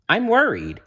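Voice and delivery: put-on high, fretful old lady voice; worried old lady. Missus Wood worrying over her dog, fretful voice. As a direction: fret like a fussy owner. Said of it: "She hasn't been herself".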